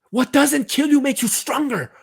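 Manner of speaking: very high, loud rallying cry; impassioned, high-pitched yell; rousing shout. Acted. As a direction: shout it to fire up the player. Fired-up pep talk to player.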